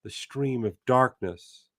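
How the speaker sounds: slow, measured reading aloud; literary reading. A narrator's reading. Teacher reading the novel aloud to class, measured narration. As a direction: savor each image of the passage.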